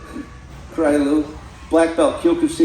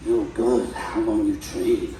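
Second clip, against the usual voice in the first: put-on low, slowing Brazilian accent; 7 semitones lower, slower than usual. Gracie champion questioning a student, low slow Brazilian accent. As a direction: imitate a Brazilian fighter, low and drawn out. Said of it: "with his Brazilian accent".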